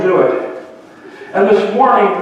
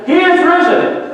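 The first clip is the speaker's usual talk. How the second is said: high, ringing shout of the proclamation; louder yell; 10 semitones higher than usual. Retold or imitated. Priest calling again, louder, over the rally. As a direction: belt it out louder. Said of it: "He yelled a little bit louder".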